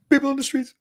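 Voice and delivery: high giggly squeal; laughing. Real, not acted. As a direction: laugh through the words. Cracks up at own joke.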